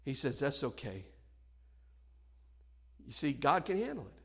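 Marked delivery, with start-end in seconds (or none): 0.4-1.0 very soft, low acted reassurance; gentle forgiveness. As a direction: forgive quietly, voice right down. God's acted forgiving reply.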